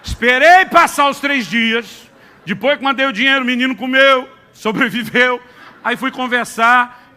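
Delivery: loud, high, emphatic pulpit delivery; preaching at full force. Acted. Pastor tells the church about his son, preaching loudly. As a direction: preach it out to the whole congregation, full power.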